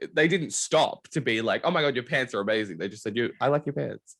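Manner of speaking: usual speaking voice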